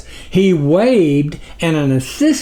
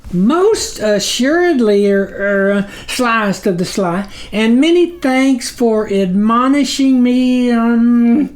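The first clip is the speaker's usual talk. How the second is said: high squeaky flattery; lightly voiced small figure, high and eager; groveling tiny creature; 8 semitones higher than usual. A narrator's reading. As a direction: fawn nervously over the sublord. Snuffer flatters the sublord.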